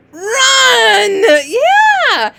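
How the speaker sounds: high, loud cheer; excited shout. Shown as a demonstration. Teacher celebrating a reader's word, high excited shout. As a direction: shout the answer in triumph.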